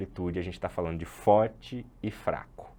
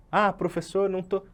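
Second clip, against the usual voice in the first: mock student complaint; 9 semitones higher than usual. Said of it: "todo professor de música adora falar".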